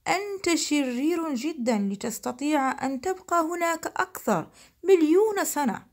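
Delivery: ordinary delivery, nothing marked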